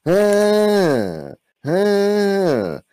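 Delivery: long high wail falling away; mock scream. Comic imitating the screaming he woke up to, mock scream. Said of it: "the sound of a guy screaming".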